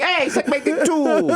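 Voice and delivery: put-on high, slow, drawn-out little-kid yell; baby from the carriage. Baby coming out the carriage, calling in a high kid voice. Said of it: "he comes out the carriage like".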